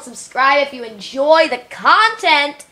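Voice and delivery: usual speaking voice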